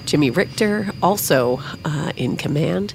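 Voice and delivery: airport announcement. Acted. Captain addresses the cabin.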